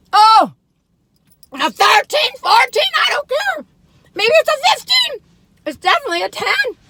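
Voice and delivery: high, loud, excited shouting; ecstatic rating. Real, not acted. Reviewer scoring a cookie off the scale, ecstatic shouting. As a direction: shout the scores, carried away.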